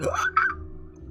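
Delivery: high, sharp mock gasp; starstruck gasp. Streamer retells hearing the artist follows them, starstruck gasp. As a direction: gasp as if thrilled beyond words. Acts own starstruck reaction in story.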